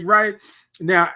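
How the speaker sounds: normal talking voice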